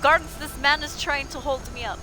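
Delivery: role-played cry for help; calling for help. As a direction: summon the guards urgently.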